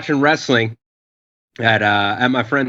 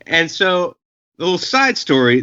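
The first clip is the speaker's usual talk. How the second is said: ordinary talk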